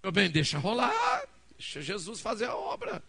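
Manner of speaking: hammed-up yell to let it be; exasperated shouted reply. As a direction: belt it out, stretching the middle. Acted loud answer to his wife.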